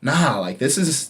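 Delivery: normal talking voice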